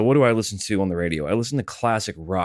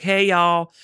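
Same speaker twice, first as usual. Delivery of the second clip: higher-pitched woman caller; mock Southern caller; 10 semitones higher than usual. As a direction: greet like a Southern radio caller. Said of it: "this chick called and she's like".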